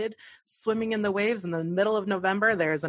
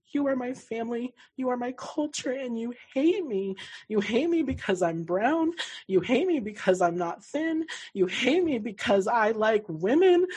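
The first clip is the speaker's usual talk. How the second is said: tearful; 6 semitones higher than usual. Retold or imitated. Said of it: "I bawled my eyes out".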